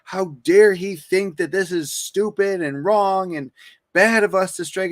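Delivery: mock outrage. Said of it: "And everyone's like".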